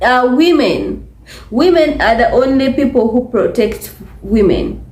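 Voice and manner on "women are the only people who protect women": ordinary talk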